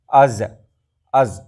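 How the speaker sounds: از with no breath after the z; از said as a stopped z with no puff of air; zaal sound, no air. Shown as a demonstration. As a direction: cut the air off right after the z in از. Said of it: "آپ کے منہ سے کوئی ہوا نہیں نکلنی چاہیے".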